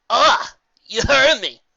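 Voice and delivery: smug griffin, loud and slow; lightly voiced griffin. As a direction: preen at being recognised. Griffin character voice.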